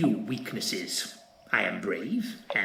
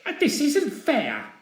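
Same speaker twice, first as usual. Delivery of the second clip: put-on much higher childish whine; petulant whine; 4 dB louder than usual. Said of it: "stamped his little feet".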